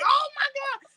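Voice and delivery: very high, thrilled exclamation; excited squeal. Retold or imitated. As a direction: gasp it out in delight. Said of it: "we would all get all excited".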